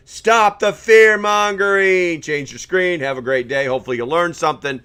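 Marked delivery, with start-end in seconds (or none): 0.1-2.2 slow, loud, high mocking shout. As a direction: bellow it slowly in mockery. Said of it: "October Skies is telling me".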